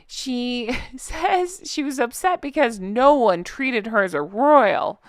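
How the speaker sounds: put-on slow, whiny toddler pout; pouty baby voice. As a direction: sulk it like a three-year-old. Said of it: "I'm doing my pouty baby voice".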